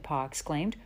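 plain reading voice

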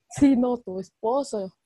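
slow, deliberate tu esposo; slow and pointed: tu esposo; stressed correction. Real, not acted. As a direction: spell out tu esposo slowly, insisting. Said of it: "esposo dejó de ser tu papá".

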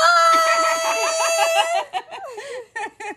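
high shrieking burst of laughter; loud laugh. Real, not acted.

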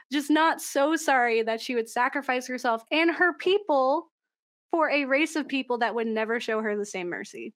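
ordinary delivery

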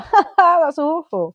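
high, loud laughing delivery; acted-out delighted cringe with a loud laugh; amused mock reaction. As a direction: laugh through it, pitched well up. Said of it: "where you're like".

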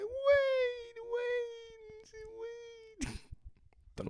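high, crying plea that fades out; imitating a frantic woman. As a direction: mock-desperate squeal of wait. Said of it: "It was like".

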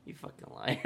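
whispered retold aside, under the breath; muttered accusation. Kid facing his old bully's denial, whispered accusation. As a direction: hiss it softly, mock resentful aside.

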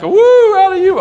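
high, loud whoop demo; overbright kids party host. Shown as a demonstration. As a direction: yelp it out for the kids. Pastor demonstrates woo.

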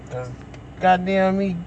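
usual speaking voice

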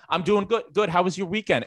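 high-pitched small talk on autopilot; chatty NPC coworker. Retold or imitated. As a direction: mimic her chirping back at someone rushing past. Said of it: "And she will go".

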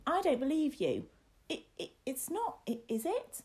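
high protest fading into stammered doubt; defiance crumbling. Acted. Boy doubts the lad, high defiance turning to frightened stammer. Boy's denial turns to fear.

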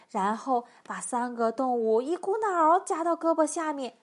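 ordinary delivery, nothing marked

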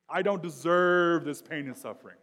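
loud, high cry of entitlement; indignant protest. Acted. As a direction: protest loudly as if wronged.

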